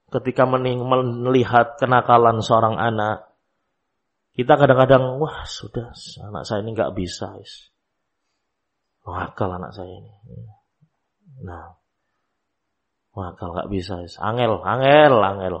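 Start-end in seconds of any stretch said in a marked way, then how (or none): 5.2-15.6 soft, resigned sigh of a worn-out parent; exasperated parent. Retold or imitated. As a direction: mutter it softly like a parent giving up. Parent exasperated by crying child. (orang tua pasrah, suara pelan mengeluh)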